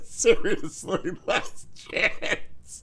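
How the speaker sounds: high wheezing laugh, whispered warning; breathless laughter. Real, not acted.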